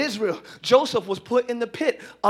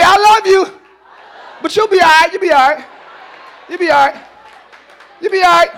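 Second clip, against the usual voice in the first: preaching to neighbor; 4 semitones higher, 8 dB louder than usual. Acted. Congregation repeat to neighbor.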